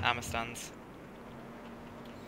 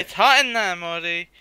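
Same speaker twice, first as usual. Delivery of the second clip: exasperated shout; 3 semitones higher, 8 dB louder than usual. Acted. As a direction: complain loudly at the nagging. Pushing back at nagging parent.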